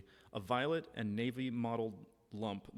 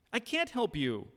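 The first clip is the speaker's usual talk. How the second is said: much higher, louder insistent no; raised, strained repeat; forceful refusal; 10 semitones higher, 6 dB louder than usual. Acted. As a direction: push the refusal out hard. Acted quarrel, repeated refusal.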